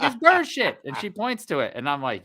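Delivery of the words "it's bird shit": put-on high, loud kid voice; matter-of-fact child, pitched up; little girl. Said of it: "She was like".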